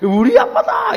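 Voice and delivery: put-on high, drawn-out child's shout; gleeful announcement, pitch way up; proud little boy. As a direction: imitate a boy boasting to his friends. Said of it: "친구들".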